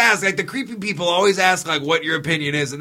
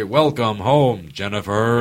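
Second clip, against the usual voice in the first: slow, lower robotic greeting; smart house voice; 8 semitones lower, slower than usual. Retold or imitated. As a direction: announce it slowly like a smart home. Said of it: "and they say".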